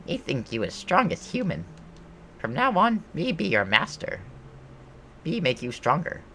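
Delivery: high goblin voice, newly impressed; bossy goblin. Acted. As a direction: declare it as a self-appointed goblin master.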